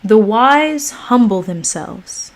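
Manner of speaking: slow, measured reading of scripture; meditative reading. Acted. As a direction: read it like a calm meditation. Reads meditation text.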